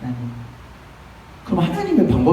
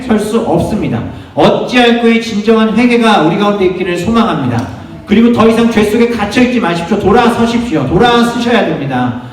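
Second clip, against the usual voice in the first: high, sustained preaching voice; fervent preaching; 7 semitones higher, 4 dB louder than usual. Real, not acted. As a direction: preach it with urgent force, pitched up. Preaches with raised intensity. (힘주어 외치는 설교조)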